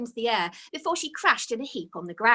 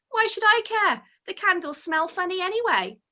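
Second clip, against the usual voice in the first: dismissive; 9 semitones higher than usual. Acted. Twigs dismissive.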